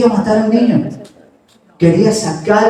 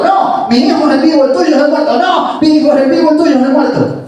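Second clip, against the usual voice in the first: heated quarrel; 7 semitones higher, faster than usual. Acted. Quarrel escalates, insistent.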